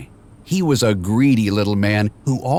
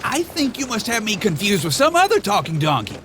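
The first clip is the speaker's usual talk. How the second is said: high, fast, chattering donkey; fast, higher Donkey voice; Donkey's voice; 9 semitones higher, faster than usual. A narrator's reading. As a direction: rattle it off, baffled. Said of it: "he said".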